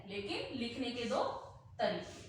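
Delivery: ordinary delivery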